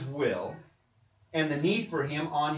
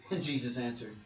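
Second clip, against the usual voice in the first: ordinary delivery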